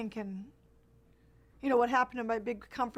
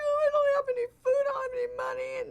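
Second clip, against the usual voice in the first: very high, tearful squeal; imitated naive wailing; 5 dB louder than usual. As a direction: mimic your younger self crying helplessly, pushing the pitch way up. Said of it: "I must have sounded like an idiot".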